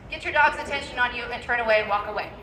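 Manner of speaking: ordinary delivery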